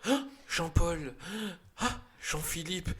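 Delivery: a hint of a hammed-up grieving hero, slow; mock mourning cry. Mocking Jean Reno's character as he mourns his friends, slow mock lament. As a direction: stretch each name out in mock grief.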